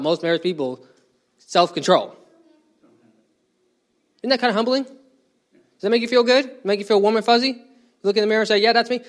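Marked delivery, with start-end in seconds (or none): 4.2-9.0 sarcastic. Acted. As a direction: needle them with mock cheer. Mocking listeners' self-image.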